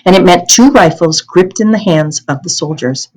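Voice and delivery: ordinary delivery, nothing marked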